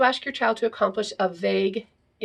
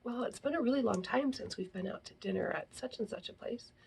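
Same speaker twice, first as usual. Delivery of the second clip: hinting whisper; timid whispered hint; dropping a hint; 2 semitones higher, 11 dB quieter than usual. Retold or imitated. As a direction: breathe the hint out, barely voiced. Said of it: "they'll drop hints".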